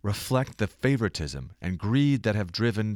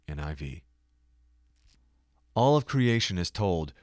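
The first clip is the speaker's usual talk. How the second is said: ordinary delivery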